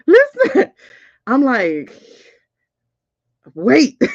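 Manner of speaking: high, loud laughter breaking into the words; giddy laughing. Real, not acted. Reader telling viewers about the dates, laughing high. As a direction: laugh through it, overexcited.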